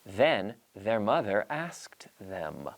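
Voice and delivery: "Then their mother asked them" very slow, each th voiced; slow tongue twister. Shown as a demonstration. Teacher modelling the tongue twister slowly for students. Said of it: "our tongue twister is".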